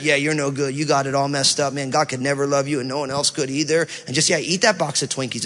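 lightly voiced taunting self-doubt; sneering inner critic. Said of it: "internal critic that all day long you hear that".

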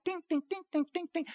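high, soft imitated ball pings; playful high ding sounds; ping pong dings. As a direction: tap out a ping pong rally. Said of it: "like ping pong".

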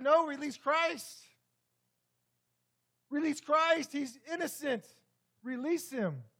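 imagined bystander crying out for release, loud and high; bold protest. Pretend. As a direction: call out boldly for his release. Imagined bold defense of Christ.

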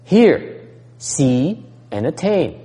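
hear punched high and loud, then see, attain; teacher stressing each word; emphatic list. Shown as a demonstration. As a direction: spell out each step with force. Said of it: "what is this person talking about".

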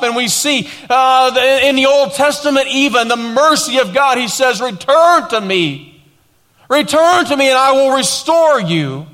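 high, loud, stretched-out sermon delivery; full-force preaching. Real, not acted. Pastor declaring God's word to the congregation, forceful preaching. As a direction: preach it with rising, driving emphasis.

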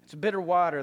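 usual speaking voice

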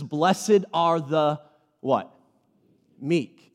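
slow drawn-out blessed are the, then pause; slow call and response. Shown as a demonstration. Pastor drawing out Jesus' words for the congregation. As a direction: leave the last word for the crowd. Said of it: "call and response quoting Jesus".

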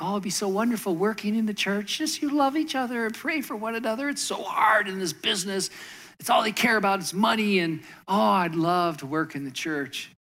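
a hint of a starry-eyed outsider; mock naive churchgoer. People to the pastor about church work, light mocking voice. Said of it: "people think".

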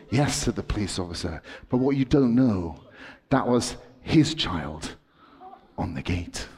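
tense story, pitch climbing as the bus speeds; dramatic storytelling. Acted. Dramatic story told to kids.

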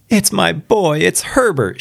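high-pitched pleading outcry; old woman's cry. A narrator's reading. Wife struggling with her husband to reach her son, high cry. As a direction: cry it out high, at moderate volume. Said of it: "she cried".

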